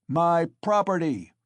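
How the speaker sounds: loud, high furious shout; angry shout. A narrator's reading. Mikolka with bloodshot eyes answering the crowd, furious. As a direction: spit it out, defiant. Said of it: "shouted Mikolka".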